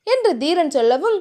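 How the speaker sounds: ordinary delivery, nothing marked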